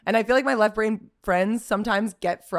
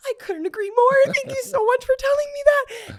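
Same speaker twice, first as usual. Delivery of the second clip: very high, sweet mock gratitude; sugary agreement. Friend charms cop, high sugary voice. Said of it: "she was like".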